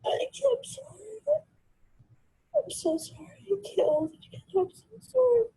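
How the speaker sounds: tearful apology. Real, not acted. Crying apology repeated.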